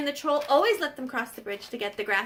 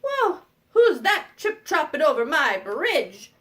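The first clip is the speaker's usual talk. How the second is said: loud, drawn-out troll challenge; evil troll; 6 dB louder, slower than usual. Acted. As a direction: call out and stop the trespasser, slow and loud. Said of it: "the evil troll goes".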